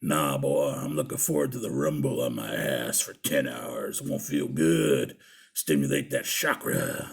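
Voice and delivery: very deep, slow biker voice; mock gruff biker, savouring it slowly; imitated big biker. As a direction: drop way down and draw it out like a burly biker. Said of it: "And he's like".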